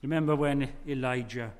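ordinary talk, nothing marked

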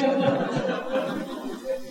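short airy laugh at his own joke; breathy laugh. Real, not acted. Preacher laughing at his honeymoon story, airy chuckle. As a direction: let a soft chuckle slip out.

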